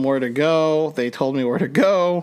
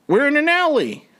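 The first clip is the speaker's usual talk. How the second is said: shocked disbelief; 12 semitones higher than usual. Retold or imitated. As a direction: pitch jumps way up in shock. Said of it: "And it was like".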